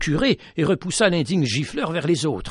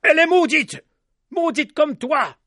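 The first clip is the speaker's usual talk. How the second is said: high, loud yell of curses; 13 semitones higher, 3 dB louder than usual. A narrator's reading. Crowd yelling that she is cursed, loud. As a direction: scream the curse at them. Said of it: "ils se mirent à crier à tue-tête".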